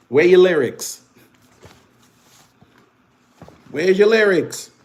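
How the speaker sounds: mocking challenge to viewers; taunting call-out. Real, not acted. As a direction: demand an answer with a jeer.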